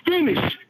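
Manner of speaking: high, punchy single word; emphatic finish. Real, not acted. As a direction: hit it hard like a slogan. Caller stresses how to finish.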